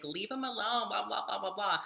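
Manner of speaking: high-pitched mock pleading of protective friends; protective friends imitated. Voicing friends shielding her from harasser.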